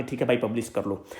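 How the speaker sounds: low, soft, mock heavy-hearted consent; reluctant agreement. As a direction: give in quietly, as if reluctantly. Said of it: "with a heavy heart". (भारी मन से हामी भरते हुए)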